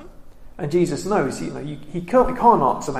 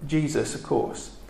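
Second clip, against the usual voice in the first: usual speaking voice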